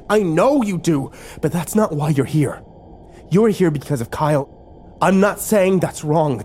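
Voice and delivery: high, strained argument; heated insistence. A narrator's reading. Arguing about being here for Kyle, heated insistence. Quarrel over why they are here.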